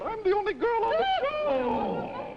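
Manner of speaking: high, slow mock girlish voice; imitating Beverly. Host teasing his guest Beverly in a high, slow mock girlish voice. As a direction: put on a high little-girl voice to tease her. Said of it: "she said".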